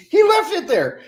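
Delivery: high, breaking into laughter; laughing. Real, not acted. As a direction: laugh through the words. Laughing at Charlie's thumbs down.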